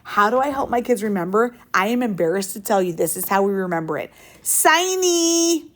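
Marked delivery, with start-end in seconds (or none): 4.4-5.7 high, drawn-out whine on siny; whiny voice. Shown as a demonstration. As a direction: whine the word like a kid. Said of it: "you gotta do it in the whiny voice".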